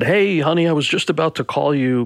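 high-pitched mock agent hello; agent's cheery greeting. Agent phones actor with good news, imitated upbeat greeting.

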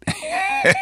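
short high squeal of a laugh; amused by the made-up accent. Real, not acted.

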